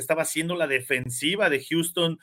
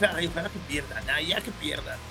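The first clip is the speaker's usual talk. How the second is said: whiny fan; 2 semitones lower, 7 dB quieter than usual. Retold or imitated. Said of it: "cada fin de semana es".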